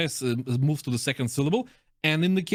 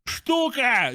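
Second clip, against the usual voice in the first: radio callout; 10 semitones higher than usual. Pretend. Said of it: "For example".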